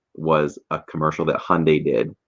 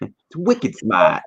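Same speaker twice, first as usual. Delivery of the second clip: slow Boston drawl on "wicked smart"; Boston accent; 5 semitones higher, much slower than usual. Retold or imitated. Host imitating Boston slang at work. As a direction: stretch it out like a Bostonian. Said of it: "ran around work doing that".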